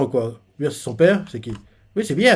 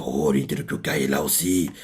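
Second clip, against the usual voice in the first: guys muttering; 12 semitones lower, 5 dB quieter than usual. Retold or imitated. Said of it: "les gars se disent".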